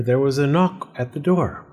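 ordinary delivery, nothing marked

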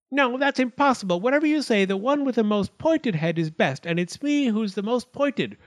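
high-pitched, indignant little arguer; squabbling acorn. A narrator's reading. Pointed acorn argues before the judge, high and boastful. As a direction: pipe up to contradict, high and insistent. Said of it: "the acorns set up a commotion again".

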